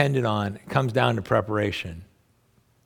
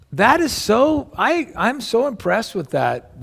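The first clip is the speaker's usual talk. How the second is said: genuinely impressed; 10 semitones higher, slower than usual. Real, not acted. Praising daughter's preparation.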